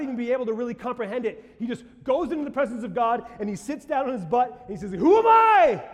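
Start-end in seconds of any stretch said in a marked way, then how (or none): 4.9-5.8 high, loud, overwhelmed outcry; David crying out before God in awe; awed cry. Acted. David sitting before God, crying out loud in awe.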